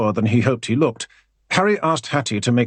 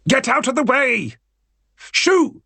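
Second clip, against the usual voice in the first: ordering the dog away; 13 semitones higher than usual. A narrator's reading. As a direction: yell at the dog blocking the door. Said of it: "ordered Harry".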